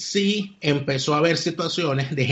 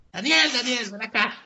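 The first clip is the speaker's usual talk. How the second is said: llamando a alguien; 7 semitones higher than usual. Retold or imitated. Jaime llama a Daniel al verlo.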